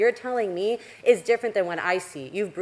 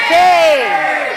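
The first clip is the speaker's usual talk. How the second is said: full shout of shame, higher and louder still; 9 semitones higher, 15 dB louder than usual. Real, not acted. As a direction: yell it at the top of your lungs. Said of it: "I want them to yell and sell shame".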